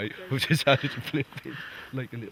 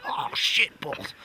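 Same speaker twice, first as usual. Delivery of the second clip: put-on slow, whispery drunk Aussie swearing; drunk Australian woman; 7 semitones higher, much slower than usual. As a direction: slur it like a tipsy Australian woman with a cigarette in her mouth.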